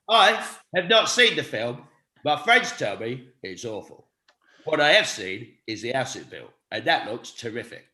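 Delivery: deadpan impression with long pauses, sinking in pitch; Michael Caine. Shown as a demonstration. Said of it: "random Michael Caine, Caine impression".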